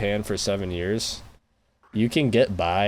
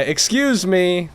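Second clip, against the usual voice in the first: higher, louder "Excuse me" with a falling end; 8 semitones higher, 4 dB louder, slower than usual. Pretend. Mocking how to get by in Japan, a loud polite call. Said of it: "really loud in every conversation".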